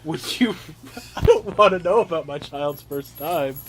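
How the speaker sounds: ordinary talk, nothing marked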